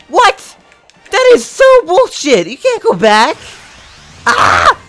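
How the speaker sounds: high furious yelling at the game; angry shout. Real, not acted. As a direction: rage at the screen. Raging at game.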